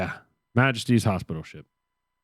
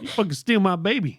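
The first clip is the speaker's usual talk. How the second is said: laughing crazed woman; 10 semitones higher than usual. Pretend. Acting out the baby thief.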